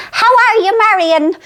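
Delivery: high, bright-pitched imitation of her mother asking; mother's voice. Phoning her mother, who answers in a high mock voice. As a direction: pipe it up high like the mother on the phone. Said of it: "she goes".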